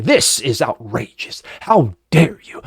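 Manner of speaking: slow, hammed-up fake indignation; mock outrage. Host mocks Republicans' staged outrage, slow and hammed-up. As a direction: lampoon a politician pretending to be mad. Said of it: "gonna come out and say".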